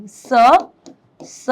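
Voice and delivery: स repeated as a separate short "suh"; isolated letter sound. Shown as a demonstration. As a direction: sound out स, pause, then स. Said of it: "यहाँ देखो stop और sulking".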